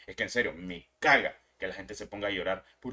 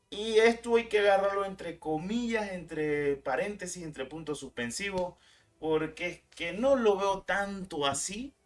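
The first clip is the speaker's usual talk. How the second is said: sarcastic air quotes; 8 semitones higher, slower than usual. Real, not acted. Ironic air-quotes aside.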